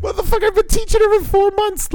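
very high-pitched exasperated protest; hammed-up disbelief; comic indignation. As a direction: squeak it out in mock outrage. Acted indignant comeback to joke.

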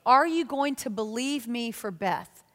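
normal talking voice

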